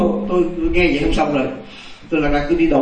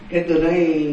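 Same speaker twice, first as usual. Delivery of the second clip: usual speaking voice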